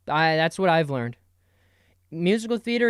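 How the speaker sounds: ordinary talk, nothing marked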